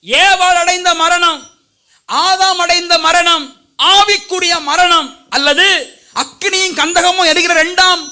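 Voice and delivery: high, slow, drawn-out proclamation; full-force preaching. Real, not acted. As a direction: hammer out each phrase from the pulpit, high and slow. (உயர்ந்த குரலில் ஆவேசப் பிரசங்கம்)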